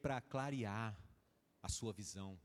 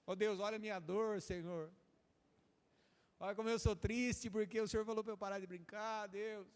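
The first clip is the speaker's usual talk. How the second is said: higher, hammed-up sulky complaint; mock whining believer; 6 semitones higher than usual. Believer whines to God in a mock childish sulk.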